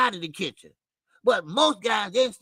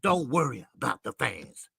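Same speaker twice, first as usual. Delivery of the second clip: quoted advice; 8 semitones lower, 5 dB quieter than usual. Retold or imitated. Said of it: "Andre Ward said".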